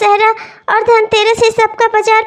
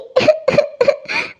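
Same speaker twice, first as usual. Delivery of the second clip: sobbing; 5 semitones higher, 5 dB quieter than usual. Acted. Crying to brother-in-law.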